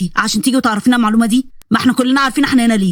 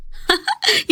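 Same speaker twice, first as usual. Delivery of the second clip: laugh; 9 semitones higher, 6 dB quieter than usual. Acted. Laughs at traitor.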